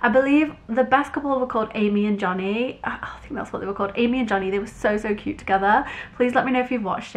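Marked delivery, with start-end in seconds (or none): 2.9-3.9 whispering. Real, not acted. Quick unsure aside.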